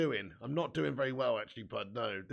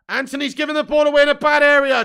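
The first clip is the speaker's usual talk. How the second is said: loud, high cry at the bad pass; sudden outburst; 11 semitones higher, 18 dB louder than usual. Real, not acted. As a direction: shout at the screen in alarm. Reacting live to match action.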